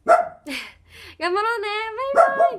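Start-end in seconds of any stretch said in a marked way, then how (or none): 2.1-2.6 高く大きく弾むバイバイ; はしゃいで手を振るように; 元気なバイバイ. Real, not acted. 明るく別れの挨拶. (配信の締めにファンへ元気よくバイバイ)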